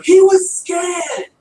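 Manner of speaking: put-on high, loud British-accented yell, drawn out; yelling Doctor. Podcaster imitating the Doctor defending the pig, mock British yell. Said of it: "The doctor yells at him".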